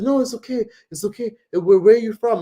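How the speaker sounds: high-pitched imitation of a persistent man; insistent reassuring stranger. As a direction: mimic a stranger talking away someone's fear. Voicing stranger insisting, asking.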